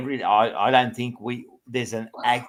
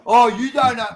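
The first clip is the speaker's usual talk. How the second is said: higher, louder, mocking echo of Mark; mocking whine; 12 semitones higher, 7 dB louder than usual. Host mocking Mark's objection with a whiny quote. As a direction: throw his words back at him. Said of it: "Your thing is".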